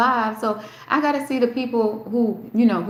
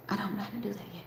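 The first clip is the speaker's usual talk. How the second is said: breathy whispered repeat, much softer; 15 dB quieter, much faster than usual. Shown as a demonstration. Livestreamer whispering to her chat, then a soft whisper. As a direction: drop to a whisper as if confiding.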